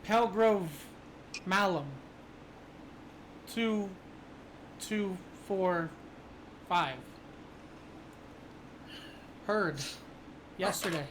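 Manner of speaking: mimicking a robotic radio voice; mimicking numbers station monotone. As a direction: copy the radio voice flatly. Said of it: "a somewhat mimicky voice say".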